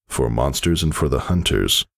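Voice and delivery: usual speaking voice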